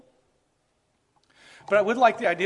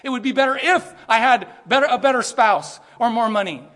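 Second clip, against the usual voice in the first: louder mock complaint to Jesus; complaining believer; 4 semitones higher, 6 dB louder than usual. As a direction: act out a whining demand. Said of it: "to not say".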